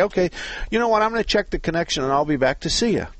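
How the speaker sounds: high, quick mock-polite brush-off; acting out a caller. The host plays a caller brushing off the shop, high and quick. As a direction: chirp it like a caller stalling the mechanic.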